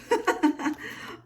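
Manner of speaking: high, breathy giggle; amused laugh. Real, not acted. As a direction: laugh lightly at the joke. Amused by gift's wording.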